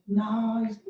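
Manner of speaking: "नाइस" with the i sound drawn out long; long vowel demo. Shown as a demonstration. Teacher showing how the word sounds, "नाइस" with a drawn-out vowel. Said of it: "लंबा चौड़ा उसका उच्चारण कर रहे हैं".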